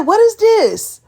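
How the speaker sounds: loud, high, indignant demand; outraged question. Retold or imitated. To the bartender about the salted rim, loud indignant. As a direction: call her out sharply across the bar.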